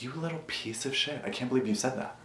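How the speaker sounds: soft disgusted self-rebuke; quiet self-scolding. Retold or imitated. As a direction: scold yourself under your breath. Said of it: "I sat there and I was like".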